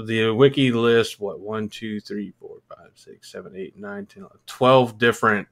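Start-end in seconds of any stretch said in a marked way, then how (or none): 1.2-4.4 counting under breath. Real, not acted. Counting bands off a list.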